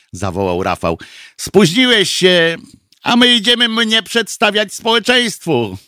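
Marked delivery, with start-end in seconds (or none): 1.4-5.8 higher, lightly gloating tease; teasing. A narrator's reading. Candidate brushes off his late rival, mildly gloating tease. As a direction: tease the latecomer in a raised voice. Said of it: "Zaśmiał się i mrugnął okiem".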